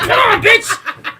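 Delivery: high, loud, slow taunting challenge, laughing; mock taunt. Squaring up to the groundhog, a loud slow laughing taunt. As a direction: goad the animal to fight.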